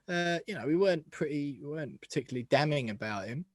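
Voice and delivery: ordinary talk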